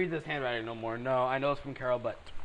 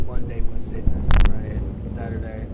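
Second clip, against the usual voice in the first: exasperated listing; 14 dB louder than usual. Real, not acted. Reading Sam's heavy work schedule.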